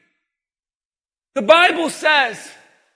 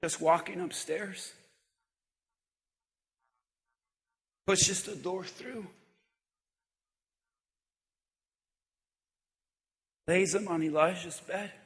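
zachte verteltoon preek; 7 semitones lower, 10 dB quieter than usual. Acted. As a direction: draw them in softly with the story. Quiet retelling of grieving mother.

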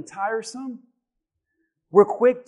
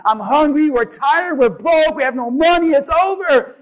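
high, loud acted-out griping; loud complaining; 7 semitones higher, 9 dB louder than usual. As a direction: pile up the complaints, imitating a man who's had it. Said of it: "he started complaining".

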